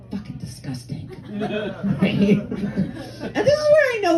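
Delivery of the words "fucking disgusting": hushed, disgusted mutter; low, very soft revulsion; disgusted aside. Acted. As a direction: drop to a near whisper in disgust. Disgusted aside after saying.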